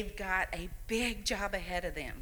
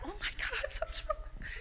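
high, thin mock panic with a nervous laugh; panicked thought; 5 dB quieter than usual. Grandmother recalling her fear at the birth, high nervous panic. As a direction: relive the scare at seeing the purple newborn. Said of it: "and I thought".

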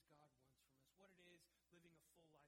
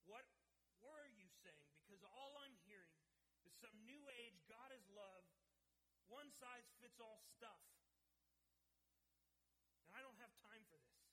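exasperated rant; 3 semitones higher, 6 dB louder than usual. Retold or imitated. Man interrupts chaplain, frustrated.

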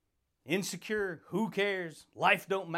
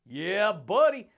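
louder, slow stretched-out hype; drawn-out hype call; 6 dB louder, slower than usual. Acted. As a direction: stretch it out to pump them up. Drawn-out shouted catchphrase.

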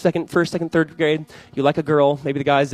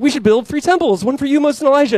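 excited Peter; 11 semitones higher, 6 dB louder than usual. Retold or imitated. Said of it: "He's like".

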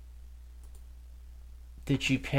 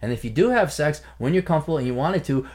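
ordinary talk

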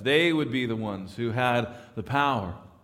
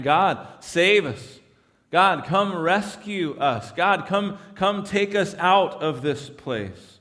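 slow, louder cry for rescue; imitated pleading prayer; 4 semitones higher, 4 dB louder, slower than usual. Preacher voicing oppressed Israel begging God for rescue, slow and loud. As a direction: plead slowly as if crying out to God. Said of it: "what they're yelling out, what they're praying".

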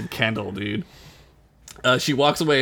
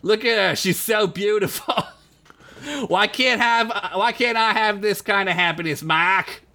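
higher whiny mock girlfriend; wistful mock whine; lightly voiced Boston girlfriend; 6 semitones higher than usual. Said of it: "friend's wedding photo and says".

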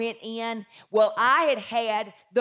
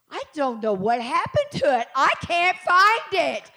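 acted disbelief swelling louder and higher; building climactic reveal; 5 semitones higher, 6 dB louder than usual. Preacher voicing the doctor's baffled finding, building to triumph. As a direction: build to a slow, high, loud finish.

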